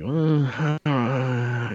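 high, drawn-out "uh" of disgust; grossed-out child. Retold or imitated. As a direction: whine queasily like a picky kid. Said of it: "and I was like".

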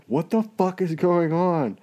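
high, slow bewildered cry; confused stoned outburst. Retold or imitated. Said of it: "audibly like".

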